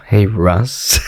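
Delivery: low, sulky greeting; mock pissy hello. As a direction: greet him as if miffed. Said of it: "You're, like, pissy at him".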